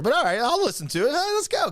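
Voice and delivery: hyped-up fan getting on board; mock excitement. Host retelling his reaction to the film, mock excited.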